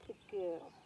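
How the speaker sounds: calm the mare with a soft murmur; soothing horse praise. Real, not acted.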